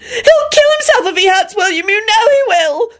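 high-pitched frantic sobbing plea. A narrator's reading. Elizabeth pleading about the wolf and William, sobbing. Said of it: "Elizabeth sobbed".

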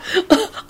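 short high soft laugh. A narrator's reading. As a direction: let out a helpless little giggle. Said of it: "laughing again".

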